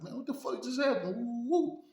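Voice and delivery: high, alarmed "what happened"; startled demand. Retold or imitated. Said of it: "He like".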